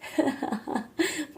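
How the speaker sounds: amused fatherly laugh; dismissive laugh. Acted. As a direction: laugh off a naive question. Father laughs off son's question.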